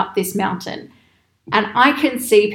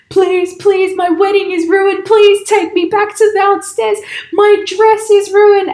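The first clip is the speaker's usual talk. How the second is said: much higher and louder, slowing, desperate; imitated pleading yell; 10 semitones higher, 6 dB louder, slower than usual. Bride begging the car to drive her back down, loud pleading wail. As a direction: wail it like a bride whose day is wrecked. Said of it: "the bride is yelling in Turkish".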